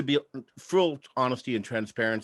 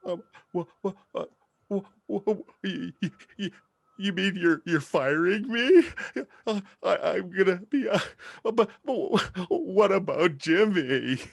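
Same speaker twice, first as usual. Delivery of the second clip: stammering panic; 5 semitones higher than usual. Retold or imitated. Worker just fired by his boss, high stuttering panic. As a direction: sputter like the floor just dropped out.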